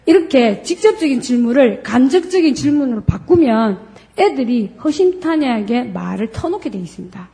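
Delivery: usual speaking voice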